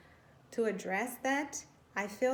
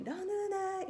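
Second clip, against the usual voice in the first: mom yelling across the house, pitched up high; imitated yell; 11 semitones higher than usual. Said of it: "She would just scream".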